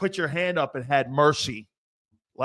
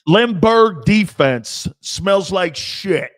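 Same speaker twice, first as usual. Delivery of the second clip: slow drawn-out joke; 3 semitones higher, 9 dB louder, much slower than usual. Acted. Joking bit, drawn out.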